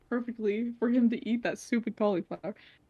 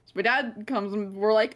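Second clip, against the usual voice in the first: ordinary delivery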